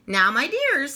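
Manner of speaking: a gentle hint of a fussing mother; mother rabbit. Mother rabbit addressing her little ones, lightly voiced.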